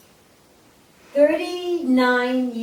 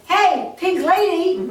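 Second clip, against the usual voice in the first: high, louder call down the hall, slowed; retold yell; 9 semitones higher, 4 dB louder, slower than usual. A stranger calls her down the showroom hall, high yell. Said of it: "someone yelled".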